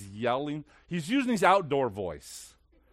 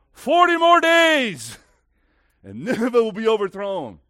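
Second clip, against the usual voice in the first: God's loud decree; 7 semitones higher, 13 dB louder than usual. Acted. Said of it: "God's like".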